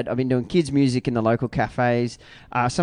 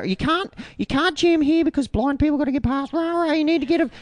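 high officious mock nagging; mock security guard; 13 semitones higher than usual. Imitating council security moving the band on, high mocking voice. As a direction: whine it like a petty official. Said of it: "They go".

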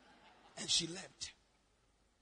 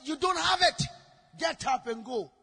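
loud, raised retold rebuke; stern rebuke; 4 semitones higher, 10 dB louder than usual. Preacher to a woman seeking counseling, stern rebuke. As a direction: dismiss her firmly at high volume.

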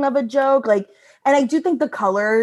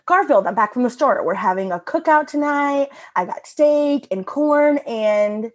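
rising sing-song run, drawing out "steak and corn"; building strip pacing; 3 semitones higher than usual. Shown as a demonstration. Showing co-hosts the strip's pacing, building excitement. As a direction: build pitch towards a hanging "and". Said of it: "the pacing of this whole strip".

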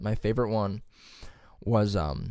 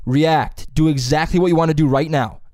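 louder coaching call; teacher's instruction; 5 semitones higher, 8 dB louder than usual. Retold or imitated. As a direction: urge the actors on, raised voice. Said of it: "He'll go".

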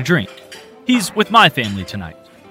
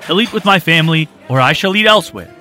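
loud, higher firm ultimatum; firm insistence; 7 semitones higher, 6 dB louder than usual. A narrator's reading. Gentleman defending vagrant to owner, firm loud ultimatum. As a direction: stand your ground.

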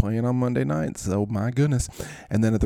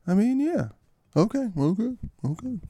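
much higher, squeaky reply; 11 semitones higher than usual. Pretend. Answering in a squeaky high voice.

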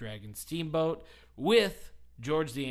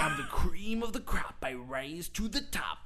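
Macho Man impression; 4 semitones higher than usual. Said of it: "he's just going".

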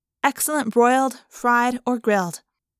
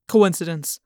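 lower, dismissive man; cold commanding voice; 4 semitones lower than usual. A narrator's reading. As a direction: dismiss it coldly. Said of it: "Chapman said".

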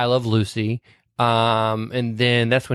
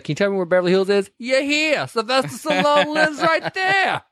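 a raspy-free goofy street guy giving directions; homeless guy voice; 11 semitones higher than usual. Retold or imitated. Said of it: "That's actually Gary Marshall".

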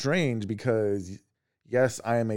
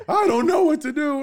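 put-on high, louder frantic kid voice; mock panicked yell; 8 dB louder than usual. Mom imitates daughter yelling in panic, high and louder. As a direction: wail it like a flustered little girl. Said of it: "she's yelling at me".

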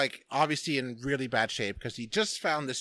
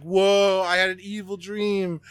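hammed-up dramatic quote; 5 semitones higher, 7 dB louder than usual. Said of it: "quoting his own song".

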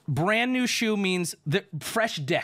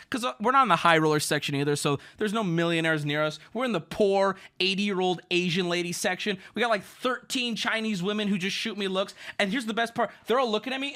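ordinary talk, nothing marked

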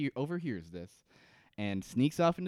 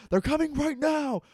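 very high, loud shouted warning; imitated shout; 14 semitones higher, 7 dB louder than usual. Carl warns the camp the Others are coming, imitated shout. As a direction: yell it high and urgent, mimicking a frantic alarm. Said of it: "He actually shouts".

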